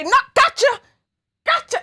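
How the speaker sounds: high, loud, teasing comeback; mock taunt. Dodging the viewer's pretend whip, a high, loud mock taunt.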